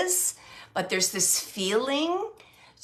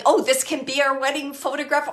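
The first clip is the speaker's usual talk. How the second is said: louder, light jokey tease; joking; 5 semitones higher, 7 dB louder than usual. Retold or imitated. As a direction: toss it off as a playful joke. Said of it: "joked about it".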